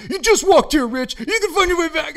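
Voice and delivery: loud, high sarcastic yell; mocking yell. A friend jeering at Rich for not knowing the way, as a loud mocking yell. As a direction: jeer at him for being lost. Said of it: "you're like".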